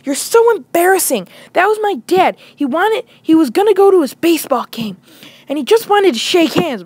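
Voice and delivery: high, whiny imitation of scolding; upset girlfriend. Girlfriend on the phone after he fled, mock scolding. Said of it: "she's like".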